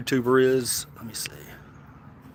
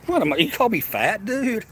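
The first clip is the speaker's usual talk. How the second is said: mock offended; 10 semitones higher than usual. Real, not acted. Reacting to a chat insult.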